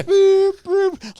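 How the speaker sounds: forklift reversing beeps, high and loud; mock forklift beeps; high loud beep imitation.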